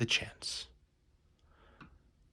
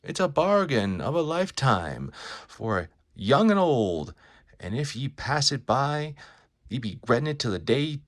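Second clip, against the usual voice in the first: loud, showy huckster spiel stretching the big words; carnival barker; 4 dB louder than usual. Acted. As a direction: sell the show to a passing crowd. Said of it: "a carnival barker".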